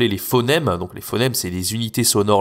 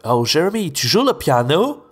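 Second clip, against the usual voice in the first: rising English-style melody on Oh, Jeremy, tu joues le piano; imitating an English speaker's lilting French; anglophone accent imitation; 5 semitones higher than usual. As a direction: mimic an anglophone family member, high and slow. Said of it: "il adorait dire".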